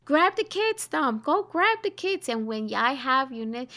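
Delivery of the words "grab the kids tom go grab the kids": urgent mock panic to her husband; panicked urging. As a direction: mom yelling at dad to grab the kids. Mother panicking on the beach.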